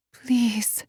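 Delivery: breathless whispered please. Acted. Silently begging Enrique to come back, whispered. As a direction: beg in a bare whisper. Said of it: "I beg Enrique with my thoughts".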